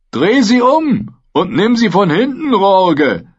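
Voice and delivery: high, loud crude jeering voice; leering Dornish spearman. Acted. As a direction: egg on his comrade lewdly. Dornischer Speerträger grölt. (grölender Speerträger)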